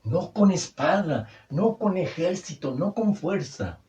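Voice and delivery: fervent preaching. Acted. Preaching climax of contrasts.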